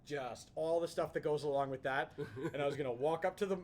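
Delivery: ordinary talk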